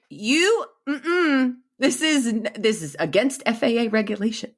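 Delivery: mock stewardess. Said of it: "The stewardesses would have been like".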